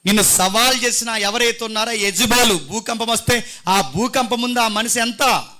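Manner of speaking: God's challenge thundered from the pulpit; drawn-out, forceful words to embolden Elijah; rousing preaching. Acted. Voices God rousing Elijah. (ఆవేశంగా ధైర్యం నింపే ప్రసంగం)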